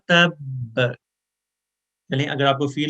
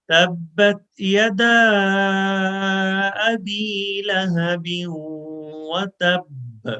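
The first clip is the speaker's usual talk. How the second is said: slow, drawn-out melodic tilawat; measured Quranic chanting; slow Quran recitation; 6 semitones higher, much slower than usual. Shown as a demonstration. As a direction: recite each word slowly for students to repeat. Full line recitation.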